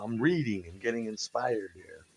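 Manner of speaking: normal talking voice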